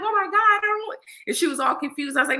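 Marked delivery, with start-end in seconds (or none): 0.0-1.0 much higher, apologetic stranger's voice; flustered stranger. Retold or imitated. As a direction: play the confused lady, pitched way up. Confused lady reacting.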